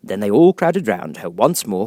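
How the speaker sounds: ordinary delivery, nothing marked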